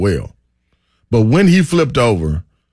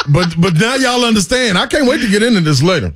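laughing; 10 semitones higher than usual. Real, not acted. Laughing about the yoga pose.

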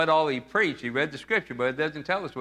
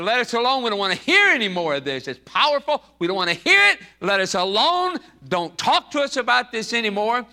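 raised, insistent mock protest; acted protest; 6 semitones higher, 3 dB louder than usual. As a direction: push back at the preacher as the possessed man. Acts the possessed man's angry protest.

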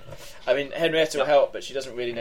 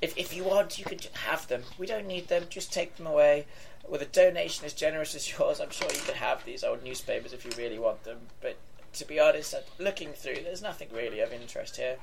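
put-on high, soft old lady voice; tired, fed-up old woman; 4 semitones higher, 5 dB quieter than usual. Old woman letting donors take the papers, soft and tired. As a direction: wearily give the papers away.

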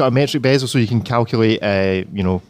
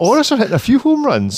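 excited; 11 semitones higher, faster than usual. Real, not acted. Excited about baseball metaphor.

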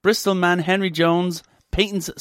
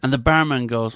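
usual speaking voice

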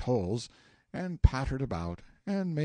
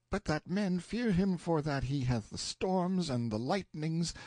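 put-on higher page voice, scornful of the old liar; grumbling page; 6 semitones higher than usual. A narrator's reading. Page cursing Merlin to the narrator, scornful grumble. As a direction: spit out his scorn of Merlin.

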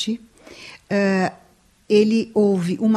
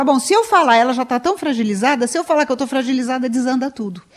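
higher, quicker worried self-talk; husband's inner reasoning; 5 semitones higher, faster than usual. Retold or imitated. Husband reasoning why he stays silent, quick worried self-talk. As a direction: think it through like a man afraid to crack. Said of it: "porque ele fala".

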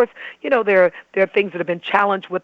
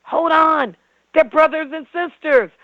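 higher-pitched protest, halting the gossip; alarmed objection; 6 semitones higher, 4 dB louder than usual. Acted. Listener halts a rumor about siblings, protesting. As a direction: cut in, insistent and raised in pitch. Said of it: "Linda Runneman says".

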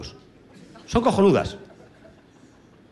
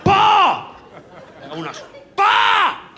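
fart noise; 12 semitones higher, much slower than usual. Retold or imitated. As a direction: blast out a mouth fart. Imitating grandma's fart.

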